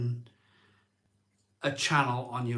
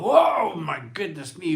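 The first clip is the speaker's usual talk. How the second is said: higher, louder mock shock at the mirror; startled exclamation; 4 semitones higher, 6 dB louder than usual. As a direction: gasp it out like seeing a stranger. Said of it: "I look at the camera and I go".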